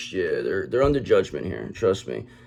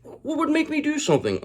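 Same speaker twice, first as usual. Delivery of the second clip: much higher, put-on squeaky voice of a pompous phony; mock indignant fraud. Tarot reader mocking the Emperor in Reverse, high squeaky indignant voice. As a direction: ham up an offended fake acting innocent. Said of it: "That's how they talk".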